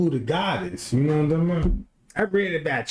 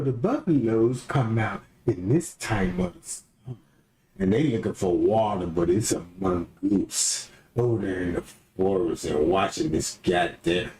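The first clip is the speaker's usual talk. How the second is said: much lower, deep nature-documentary read; mock documentary narrator; 9 semitones lower than usual. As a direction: play a solemn wildlife narrator. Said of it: "documentary voice".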